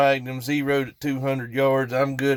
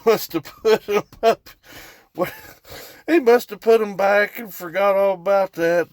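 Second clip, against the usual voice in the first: higher, chuckling through the words; laughing; 5 semitones higher than usual. Real, not acted. Telling viewers his dad forgot the shells, laughing. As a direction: laugh as the blunder sinks in.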